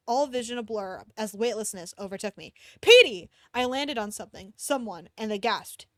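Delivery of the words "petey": sudden loud call of a name; shouted name. Acted. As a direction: cry the name out in alarm.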